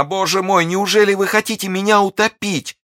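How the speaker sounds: very high, loud anguished wail; despairing cry. A narrator's reading. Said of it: "вскричала она". (вопль отчаяния)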